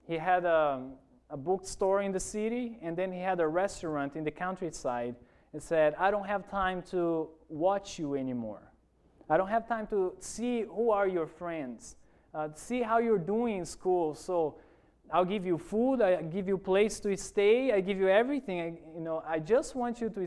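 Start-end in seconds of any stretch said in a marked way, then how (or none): none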